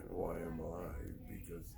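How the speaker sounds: normal talking voice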